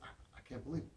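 soft, stammering mock disbelief; freaking out. Stunned boy in the car tells his driver, hushed disbelief. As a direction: act out a boy stunned almost speechless. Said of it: "he's talking like this".

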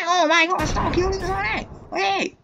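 loud high protest; exasperated yell. Real, not acted. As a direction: yell at them to stop. Yelling at enemies.

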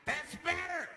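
gunman's smug high "That's better"; menacing approval. Retold or imitated. Gunman to the now-quiet bus, smug high approval. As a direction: sneer it like a pleased gunman. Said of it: "gunman approving the quiet".

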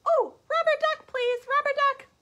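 very high, loud squeaky pig demanding syrup; pig character. Acted. The pig begging the child for maple syrup, squealing high. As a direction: squeal it like an eager piglet. Pig begs for rubber duck.

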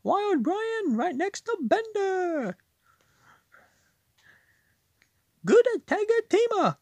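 high, odd comic voice; silly put-on voice. Gamer playing cards in a silly high voice, goofy. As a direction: ham up a goofy character voice. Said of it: "I'm a talking like a this".

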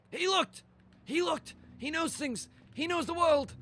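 high, excitable short bursts; excited raving. Pretend. Player raving about the G-Man, high excited exclamations. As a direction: blurt each claim high, like a revelation.

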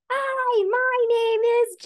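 high-pitched, drawn-out holler; huge, slow, over-loud greeting; screaming really loudly. Shown as a demonstration. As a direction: scream the introduction at full volume. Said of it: "screams really loudly and says".

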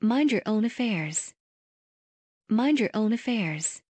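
normal talking voice

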